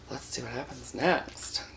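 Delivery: ordinary talk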